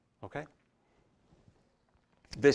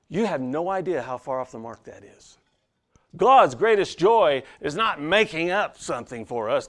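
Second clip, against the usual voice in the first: loud, driving sermon voice, pitched up; preaching at full force; 6 semitones higher, 8 dB louder than usual. Acted. Pastor rousing the congregation against a bad quote, loud full-force preaching. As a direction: hammer it home to the congregation.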